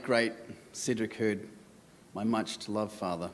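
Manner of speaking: soft, halting words of farewell; faltering tender eulogy. Real, not acted. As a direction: speak gently, pausing between phrases. Opening of father's eulogy.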